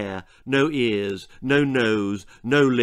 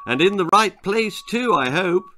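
false jollity; 7 semitones higher, 4 dB louder than usual. Acted. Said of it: "he said with false jollity".

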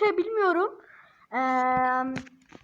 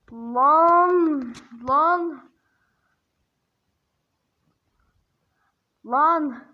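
loud exclamations; 5 dB louder, much slower than usual. Real, not acted.

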